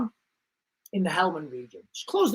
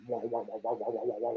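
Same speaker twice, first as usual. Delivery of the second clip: low, soft "waka waka" chopper sound; imitated rotor noise; 10 semitones lower, 13 dB quieter, much faster than usual. Describing the gunship coming, a low soft chopper noise. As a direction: mouth a distant helicopter, low and hushed.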